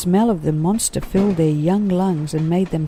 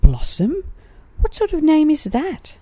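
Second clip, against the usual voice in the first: high, incredulous question; a young koala baffled by a strange name; puzzled surprise; 13 semitones higher, 4 dB louder than usual. Acted. Joey wondering at the name Blossom, high and puzzled.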